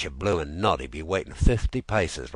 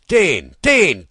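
loud, much higher bellowed call of his name; shouted call; 11 semitones higher, 14 dB louder than usual. Acted. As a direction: bark the name across the camp. Soldiers calling for the water-carrier.

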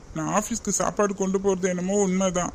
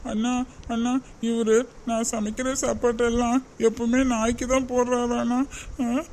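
high, tearful complaint to her brother; wife sobbing to her brother about the dog food; crying to brother; 5 semitones higher than usual. Acted. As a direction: wail it out like a wronged wife. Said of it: "எதுக்கும்மா இப்ப அழற?".

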